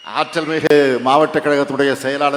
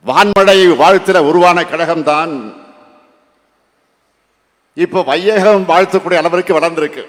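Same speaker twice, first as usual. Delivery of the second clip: thunderous political oratory; 3 semitones higher than usual. Real, not acted. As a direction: rouse the crowd from the stage. Rally speech at full force.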